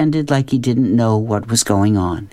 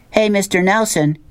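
higher-pitched call to the driver, no real yell; mild shout; 9 semitones higher than usual. Acted. Norton hails the bus driver from the back, mild shout. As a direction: call out to someone up front, restrained. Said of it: "Norton shouted".

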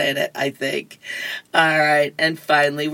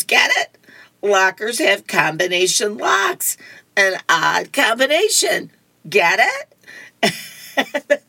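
higher, eager cartoon kid; eager joker; 4 semitones higher than usual. Linus explaining his joke to Lucy, eager and higher. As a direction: push the punchline, rising on "get it".

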